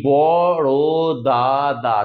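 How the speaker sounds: বড় দা, দা as bo-ro-da-da, drawn out; syllable by syllable. Shown as a demonstration. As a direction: separate the four syllables of বড় দা, দা. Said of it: "চারটে syllable আছে".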